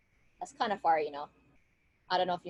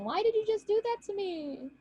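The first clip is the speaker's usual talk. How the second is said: calling out; 12 semitones higher than usual. Acted. Revived woman calling out to her killer, high and plaintive. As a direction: call after him from afar, without shouting. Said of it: "She starts calling out".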